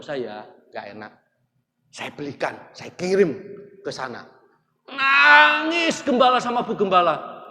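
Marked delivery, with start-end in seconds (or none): 4.9-7.5 high, loud, slow and tearful; emotional outburst. Real, not acted. Preacher telling how the pastors wept, loud and tearful. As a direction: let it break out with feeling. Emotional climax, cry heard.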